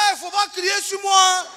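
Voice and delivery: high, loud indignant Ivorian shout; mock-furious Ivorian comeback; Ivorian temper. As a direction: snap back at the officer, offended. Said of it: "mon côté ivoirien qui est ressorti".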